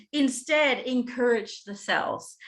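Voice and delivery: usual speaking voice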